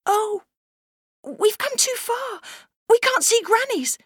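put-on very high, slow dismayed little girl; alarmed. Little girl realizing they're lost, high and alarmed. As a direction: gasp it out as the fear dawns.

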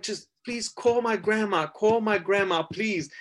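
high, slow pleading; desperate plea. Retold or imitated. Arrested teen begging cops to call grandma, slow high plea. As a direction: beg them slowly, voice up high.

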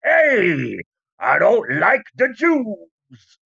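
put-on slow, casual old-timey drawl; a mock thirties guy, breezy and offhand; 1930s man voice. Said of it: "everyone in the nineteen thirties and forties just talked like that".

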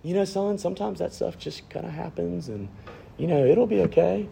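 put-on calm the boy down, softly; calm father. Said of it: "he tries to say really calmly".

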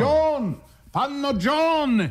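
high, drawn-out call across the office. Acted. Calling for the secretary.

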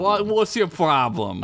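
combative, put-on New York accent. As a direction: throw it back as a New Yorker accent. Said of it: "do a New York accent".

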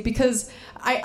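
ordinary talk

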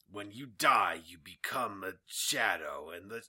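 hushed, grave trailer narration; ominous movie-trailer voice, lightly played; mock trailer narrator. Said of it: "the trailer narration was just very".

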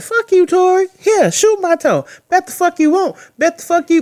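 high-pitched taunting Megan voice; mock defiant Megan. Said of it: "And she said".

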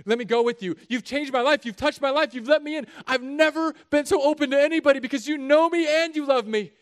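high, loud, pleading cry; desperate begging. Acted. Healed man begging Jesus to let him follow, loud and pleading. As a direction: beg him with rising desperation.

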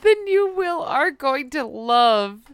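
cracking up while reading; trying not to laugh; stifling laughter. Real, not acted. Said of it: "I can't keep it together".

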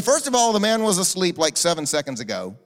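ordinary talk

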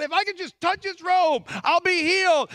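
high, loud preacher's reenactment; fervent quoted hope. Retold or imitated. Woman reaching for Jesus' robe, fervent acted resolve. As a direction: declare it with soaring conviction.

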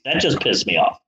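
ordinary delivery

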